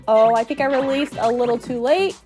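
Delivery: usual speaking voice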